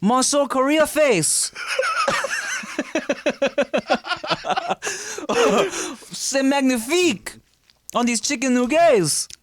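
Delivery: put-on high, slow hammy French accent; mock French chef praising the food. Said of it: "French people are so mad at us".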